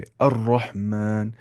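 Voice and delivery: अर्रहमान slow with a full heavy doubled r. Shown as a demonstration. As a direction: draw out अर्रहमान, thick r. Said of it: "मोटी आवाज़ से पढ़ना है". (मोटी आवाज़ से अर्रहमान)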